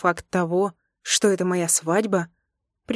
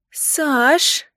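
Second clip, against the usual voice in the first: drawn out, much higher, puzzled; puzzled drawl; 8 semitones higher, 4 dB louder than usual. Acted. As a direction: stretch the name in disbelief. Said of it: "протянула я с недоумением".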